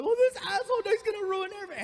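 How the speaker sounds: very high, loud distressed woman; hammed-up hysterical girlfriend; hysterical mom. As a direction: shriek in a panicked falsetto. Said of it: "Frankie hears his mom getting hysterical".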